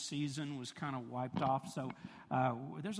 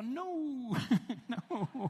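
playful no; 9 semitones higher than usual. Retold or imitated. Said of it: "I go".

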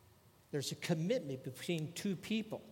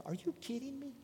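high, soft mock disbelief; light teasing exasperation in a high pitch; playful incredulity; 10 semitones higher, 5 dB quieter than usual. Shown as a demonstration. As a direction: question it softly, pitched way up. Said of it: "Look at my wife someday".

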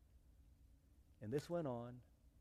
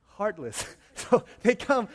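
normal talking voice

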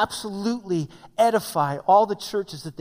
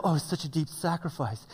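mock self-pity; 5 semitones lower, 9 dB quieter than usual. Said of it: "sit there and go".

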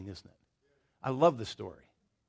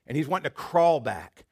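ordinary delivery

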